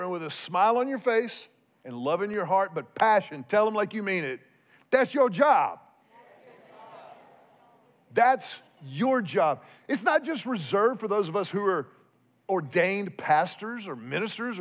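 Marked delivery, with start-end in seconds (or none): 4.9-5.8 loud, high declaration; emphatic. Real, not acted. Pastor telling the church their calling, loud and emphatic. Pastor drives home the point. 8.1-9.6 slow, weighty restatement; slow repeat. Real, not acted. Pastor pressing the point home to listeners, slow and deliberate.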